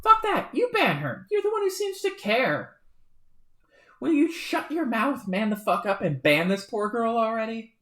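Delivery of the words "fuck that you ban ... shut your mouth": enraged outburst in a shouting match; furious shout. Acted. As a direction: scream it back at him. Furious quarrel between Karkats.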